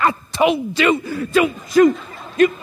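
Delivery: mock stern mother, high and drawn out; a hint of a stern church mother; scolding mom. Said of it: "She's like".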